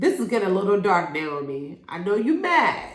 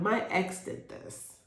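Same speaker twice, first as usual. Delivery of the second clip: hushed confession; 9 dB quieter, slower than usual. Retold or imitated. Quiet confession retold.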